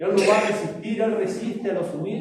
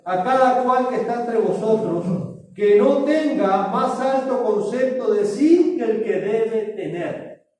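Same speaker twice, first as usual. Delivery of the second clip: louder, higher, slow preached warning; forceful scripture quote; 5 semitones higher, 5 dB louder, slower than usual. Retold or imitated. Preacher reading Paul's warning to the church, loud and slow. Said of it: "Pablo dice en Romanos doce tres".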